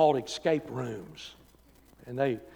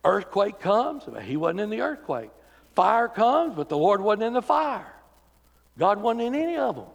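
stretched, louder calls on each element; rising preaching cadence; 6 semitones higher, 4 dB louder than usual. Acted. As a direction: build the list like thunder.

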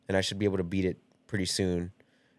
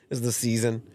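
usual speaking voice

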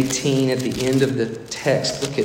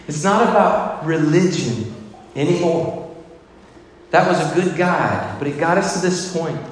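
preaching; 4 semitones higher than usual. Acted. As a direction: preach it out with full conviction. Preaching at full force.

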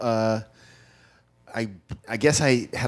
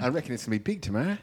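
Australian accent; 7 dB quieter, faster than usual. Shown as a demonstration. Repeating the line for the hosts, quick Australian accent. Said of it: "my Australian accent".

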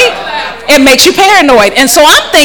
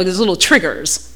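ordinary talk

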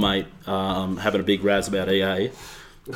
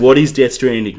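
loud demanding; 3 semitones higher, 9 dB louder than usual. Real, not acted. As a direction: shout the question.

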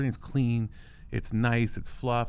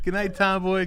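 higher, giggling through the slip; laughing wrong name; 9 semitones higher, 4 dB louder than usual. Real, not acted. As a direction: botch the name while laughing.

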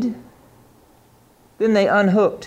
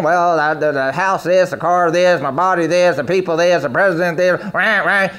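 a hint of a lower, sulky complainer; mock complainer; 4 semitones lower than usual. Preacher imitating an always complaining believer, lower mock moaning. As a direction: lower voice, rattle off the gripes like a moaner. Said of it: "If you're always complaining".

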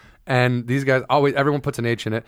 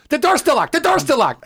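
high mock-panicked waitress; freaking out; 12 semitones higher than usual. Only the waitress is panicking over the door, high freak-out. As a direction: repeat it high, in exaggerated alarm. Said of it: "nobody was freaking out except for her".

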